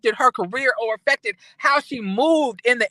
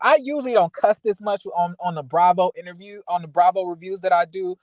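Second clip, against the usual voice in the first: ordinary delivery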